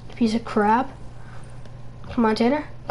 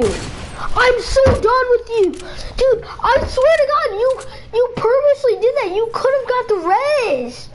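much higher, cracking whine on the edge of tears; whiny near-crying; 8 semitones higher than usual. Real, not acted. To Tanner for not reviving him, a high cracking whine.

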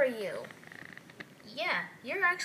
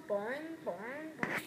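ordinary talk

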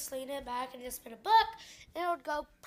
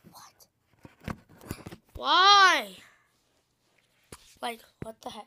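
mock shock; 3 semitones lower, 13 dB louder, slower than usual. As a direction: gasp then whispered disbelief. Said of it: "I'll be like".

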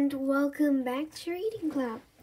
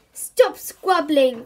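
slow, louder telling-off; crying out; 2 semitones higher, 9 dB louder, much slower than usual. A narrator's reading. Mum tells the children off, loud. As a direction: call out to stop them. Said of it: "cried Mum".

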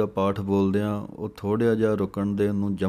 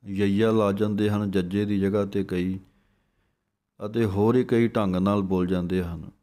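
ordinary talk, nothing marked

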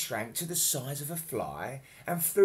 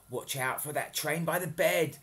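plain reading voice

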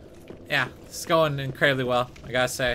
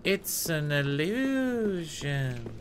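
slower, softer eerie drawl; slow mysterious voice; 5 dB quieter, much slower than usual. Pretend. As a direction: draw it out like a ghost.

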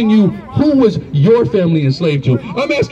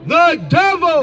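high-pitched proclamation of the verse; scripture declamation; 7 semitones higher, slower than usual. Real, not acted. Reader answering the preacher's "The who?", declamatory.

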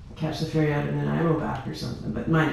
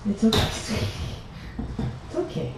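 soothing cat; 6 semitones higher than usual. Real, not acted.

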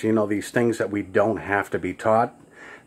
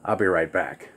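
discreet understatement; 7 semitones lower, 6 dB quieter than usual. Acted. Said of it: "more of a private thing with him".

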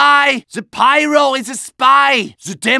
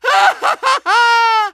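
maniacal laugh; 9 semitones higher than usual. Acted. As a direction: cackle like a mad scientist. Bird head madness.

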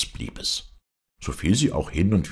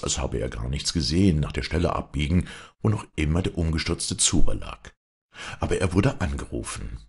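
usual speaking voice

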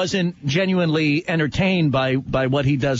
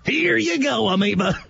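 booming radio host catchphrase, pitched up; hammed-up shock jock voice; Tom Leykis impression; 7 semitones higher, much slower than usual.

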